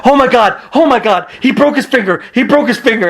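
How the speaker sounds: freaking out. Retold or imitated. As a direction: panic over a broken finger. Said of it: "Daniel Bryan was freaking out".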